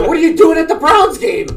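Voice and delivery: high incredulous shout. Real, not acted. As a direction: yell it in disbelief. Friend incredulous at shaving reason.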